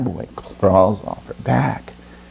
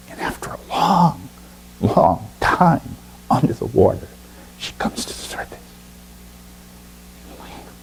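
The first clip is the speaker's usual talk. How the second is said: soft, whispered storytelling; hushed narration; 6 dB quieter than usual. A narrator's reading. Narrator tells the bird surfacing, whispered and soft. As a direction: drop to a whisper as she surfaces. Hushed after long dive.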